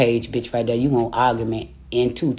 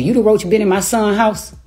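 retold call-out; 7 semitones higher, 4 dB louder than usual. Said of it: "That what I was on her live saying".

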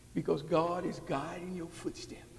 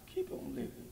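whispered echo; 13 semitones higher, 8 dB quieter than usual. Acted. Preacher repeating it to the congregation, hushed whisper. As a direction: keep on living, breathed high and soft with no voice.

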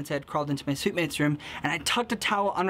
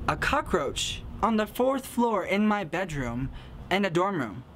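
normal talking voice

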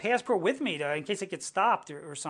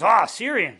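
louder, pouncing mock delight; jackpot excitement; 3 semitones higher, 8 dB louder, slower than usual. Border officer hearing the wife's birthplace, mock jackpot excitement. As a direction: imitate someone who just hit the jackpot. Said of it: "like you hit the, like, the jackpot".

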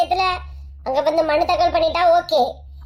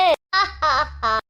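mocking laugh; 2 semitones lower than usual. Gloating over rival's candidacy.

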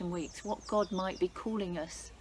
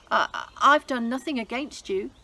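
stammering, scared and loud; trembling Haman; 4 semitones higher, 9 dB louder than usual. Acted. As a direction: stutter in panic. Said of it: "Haman trembled".

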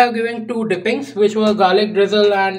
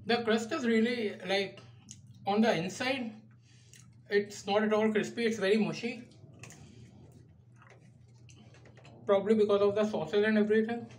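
speaking between bites; talking while chewing; 11 dB quieter than usual. Real, not acted. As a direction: mouth partly full. Seen 2: Holds pizza slice, bites at 6.4, mouth working throughout speech.

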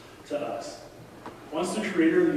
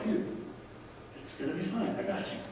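soft, higher, easy comforting tone; calm reassurance; 4 semitones higher, 8 dB quieter than usual. Retold or imitated. Father reassures his screaming baby in the storm, softly calm. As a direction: gently play down the danger. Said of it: "From my perspective, I'm like".

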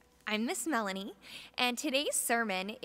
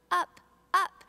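higher and louder with each up; rising excitement; 8 semitones higher, 8 dB louder than usual. A narrator's reading. Narrating the boat thrown up by the waves, soaring louder. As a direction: lift the voice up with the boat.

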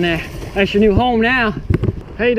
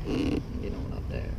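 whisper; 15 dB quieter, slower than usual. Real, not acted. Coaxing the fish.

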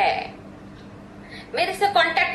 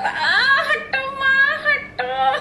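higher, slower, louder mock child yell; son's yell; 9 semitones higher, 4 dB louder, much slower than usual. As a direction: imitate the son shouting to get mom off him. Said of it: "वो चिल्ला रहा था". (बेटे की नकली चीख, ऊँची और धीमी)

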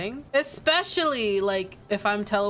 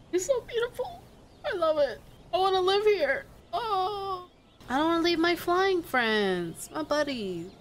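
mock crying; 7 semitones higher than usual. Real, not acted. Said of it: "I'm about to start crying".

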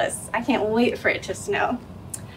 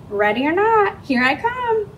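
calling out; 8 semitones higher than usual. Acted. Hide and seek, calling out.

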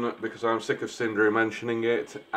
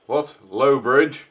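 normal talking voice

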